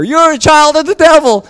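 loud, high street-preacher yell; shouted accusation. Acted. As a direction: yell a condemnation at passers-by. Shouting at strangers while street evangelizing.

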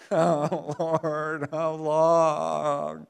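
hammed-up whine, drawn-out words; whiny. Believer complaining to God, mock whining. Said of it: "I'm so whiny spiritually".

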